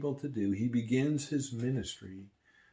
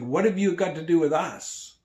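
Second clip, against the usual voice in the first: loud, higher challenging shout; demon shouting; 6 semitones higher, 9 dB louder than usual. Acted. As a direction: challenge him with a loud cry. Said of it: "it shouts out at him".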